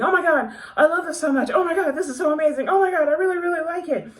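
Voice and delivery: high, loud, rapid fangirl gush; excited gushing. Retold or imitated. Reader retelling messages she sent the author, excited gushing. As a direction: imitate bombarding someone with breathless praise.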